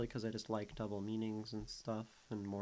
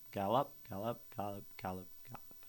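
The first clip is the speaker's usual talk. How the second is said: gallop said in a long-short bounce; gallop, gallop in a trotting beat; galloping rhythm; 4 dB quieter, faster than usual. Shown as a demonstration. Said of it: "Gallop is a trotchy".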